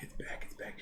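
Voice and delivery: hushed, repeated whisper; barely voiced, anxious whisper; dreading whisper. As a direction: whisper it like the pain is creeping back. Retelling dread as stomach pain returns.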